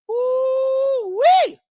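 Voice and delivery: high, loud ooh-wee; loud high delighted hoot; gleeful hoot. Real, not acted. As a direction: whoop with glee. Gleeful exclamation.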